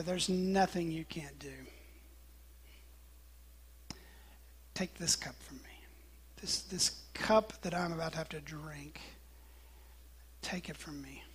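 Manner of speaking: low, near-whispered pleading; hushed prayer. Retold or imitated. Jesus begging Abba to take the cup, hushed plea. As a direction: plead softly with long pauses. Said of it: "and he prays".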